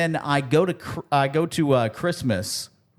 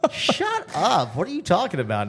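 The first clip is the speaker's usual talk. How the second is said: retold disbelief; 7 semitones higher than usual. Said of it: "I'm like".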